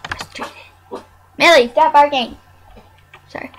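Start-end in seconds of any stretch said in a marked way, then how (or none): 1.4-2.4 louder sharp scold; scolding dog. Real, not acted. Scolding the barking dog, louder. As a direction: tell the dog off firmly.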